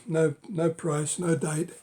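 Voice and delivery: ordinary talk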